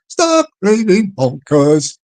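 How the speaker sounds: slow, put-on silly voice; funny voice. Shown as a demonstration. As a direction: draw it out slowly in a funny voice. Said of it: "say it in a funny voice".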